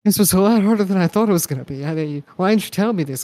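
loud, fast, rattled complaint; flustered hunter voice. Acted. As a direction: gabble it out in a panic. Plays the hurt hunter.

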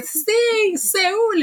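very high, drawn-out mock cheer; retold excited yell. Podcaster reacting to the surprise contest call, high imitated yell. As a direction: squeal it out like a retold celebration. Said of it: "y grité y todo".

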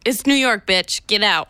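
rude New York teller. Acted. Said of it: "I'll be a New Yorker Citibank".

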